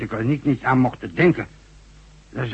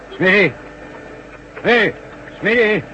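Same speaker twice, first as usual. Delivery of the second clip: calling out; 8 semitones higher than usual. Acted. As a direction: shout his name to reach him far away. Boss calls to worker far off.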